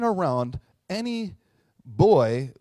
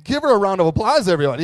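much louder, higher announcer call; mock emcee; 4 semitones higher, 9 dB louder, faster than usual. Friend plays emcee for the bleeding guy. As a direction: hype the crowd like a host. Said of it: "You would say".

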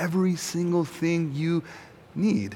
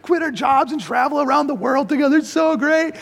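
very high, hammed-up giddy gushing; mock-euphoric, pitched way up; gushing excitement; 12 semitones higher, 3 dB louder than usual. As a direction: gush like a thrilled friend on holiday. Acted road-trip enthusiasm.

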